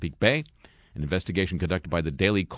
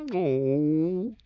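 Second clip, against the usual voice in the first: squeaky oh; 9 semitones higher than usual. Pretend. Silly squeal after the quote.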